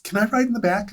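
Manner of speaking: high, eager "Can I ride in the back?"; eager request. Retold or imitated. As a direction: ask it high like an eager kid. Eager partner quoted.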